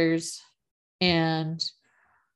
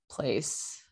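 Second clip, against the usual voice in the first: ordinary talk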